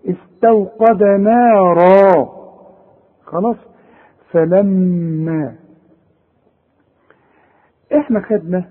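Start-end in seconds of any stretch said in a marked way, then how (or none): 0.1-2.3 slow recitation of نارا, thin نا then full را; light noon, heavy را. Shown as a demonstration. Teacher sums up the correct reading, نارا with light noon. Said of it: "اذا نقول ايه". 4.3-5.5 فلما very slow, humming long on the doubled م; held ghunnah. Shown as a demonstration. Teacher recites فلما with long nasal meem. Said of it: "الميم اللي عليها الشدة".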